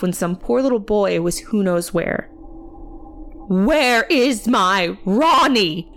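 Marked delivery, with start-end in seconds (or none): 3.5-5.9 slow, raised, forceful demand; demanding aunt. A narrator's reading. Aunt to worker over missing boy, demanding. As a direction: lean hard on every word, higher. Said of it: "his aunt demanded".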